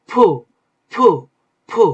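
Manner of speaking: "ফু" as a p with a puff of air, not f; aspirated ph. Shown as a demonstration. Teacher modelling the Korean letter for students, aspirated ph. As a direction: pop "ফু" from closed lips, each one separate. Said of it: "এভাবে উচ্চারণ হবে".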